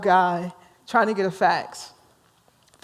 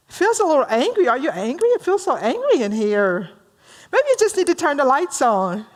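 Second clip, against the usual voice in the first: higher, over-solicitous chatter; fussing romantic; 6 semitones higher than usual. Acted. Joe asks Bob why he is angry, fussing. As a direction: swoop up high, fussing over someone's mood. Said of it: "says to Joe, the romantic".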